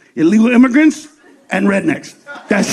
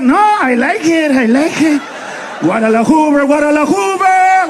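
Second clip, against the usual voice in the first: put-on higher, cheerful Mexican-accented "Guadalajara"; Mexican immigrant accent; 4 semitones higher than usual. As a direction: gladly repeat it in a Mexican accent. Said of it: "even the mojados are like".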